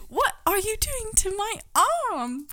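high-pitched attempt at a fussy droid; high, hammed-up robot alarm; C-3PO. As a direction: protest in a badly imitated robot voice. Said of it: "I'm not a good C three P O voice".

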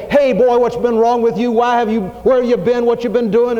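hammed-up telling-off of a boy who strayed; mock scolding. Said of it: "He doesn't say".

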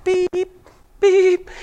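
high mock beeping like a backing truck; short, high, soft imitated truck beeps; truck reversing beeps. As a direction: beep like a garbage truck in reverse. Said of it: "the Lord is coming back".

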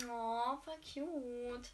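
drawn-out, slow swooning aww; gushing. Real, not acted. Reader swooning slowly over a sweet love scene. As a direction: melt over the sweet moment, stretching every word.

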